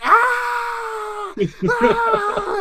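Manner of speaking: cartoon death scream as the soup boils; hammed-up dying scream. Employee boiling alive in the soup vat, hammed-up scream.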